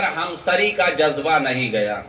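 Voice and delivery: low, falling delivery of the line; subdued first statement, pitch dropping. Real, not acted. Preacher states point before repeating it.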